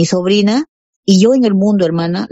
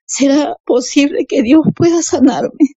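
wondering aloud; 6 semitones higher than usual. Retold or imitated. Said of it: "decía yo".

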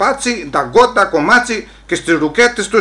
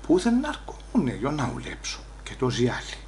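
fading quiet; 2 semitones lower, 10 dB quieter than usual. Real, not acted. Inner voice trailing.

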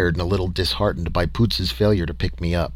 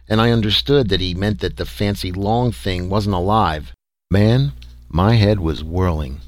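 ordinary delivery, nothing marked

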